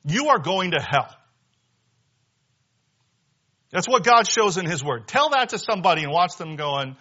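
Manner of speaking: high-pitched, forceful sermon delivery; fired-up preacher driving each phrase home; impassioned preaching. Real, not acted. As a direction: preach it with rising urgency, pitched well above normal talk. Preacher in heated full flow.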